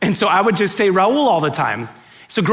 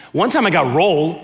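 ordinary delivery

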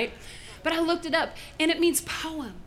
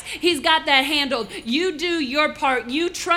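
preaching that builds louder to a shouted amen call; rousing preaching; 3 semitones higher, 7 dB louder than usual. Real, not acted. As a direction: drive it up to full pulpit force.

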